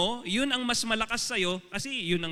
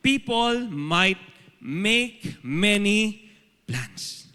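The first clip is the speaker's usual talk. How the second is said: each word drawn out slowly, louder; slow scripture reading; 3 dB louder, much slower than usual. Shown as a demonstration. Preacher reading Proverbs aloud to the congregation, slow and deliberate. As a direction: read the verse slowly and solemnly. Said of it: "Proverbs nineteen verse twenty-one".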